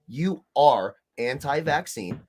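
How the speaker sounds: slow, ARE stressed; slow emphasis. Real, not acted. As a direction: lay down the verdict slowly. Emphatic conclusion of rant.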